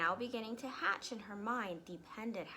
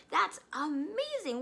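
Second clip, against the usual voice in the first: amazed; 9 semitones higher, 6 dB louder than usual. Acted. Matilda marvels at the parrot.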